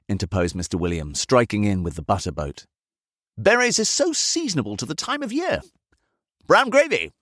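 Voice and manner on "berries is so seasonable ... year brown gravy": very high, chirpy servant voice; eager servant. A narrator's reading. Servant serving his master Christmas dinner, chirping high. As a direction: pipe up in a high character voice.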